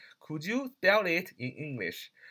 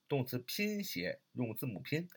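ordinary talk

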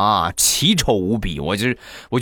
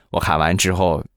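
ordinary talk

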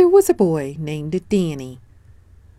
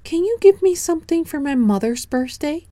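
put-on bright, much higher little boy voice; high child voice; 11 semitones higher, faster than usual. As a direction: speak up high like an eager small boy. Danny asks the hen.

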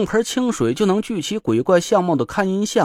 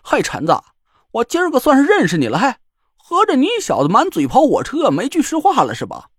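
indignant joker; 7 semitones higher than usual. A narrator's reading. Joker protesting at Chen: mock outrage. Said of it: "郭永哲可不干了".

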